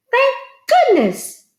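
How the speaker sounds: high delighted wife voice; relieved farmer's wife. A narrator's reading. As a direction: sound truly thankful.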